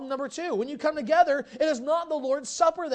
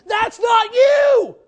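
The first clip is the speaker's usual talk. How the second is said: shouted rebuke; 11 semitones higher, 17 dB louder, much slower than usual. Acted. Preacher voices Paul's rebuke.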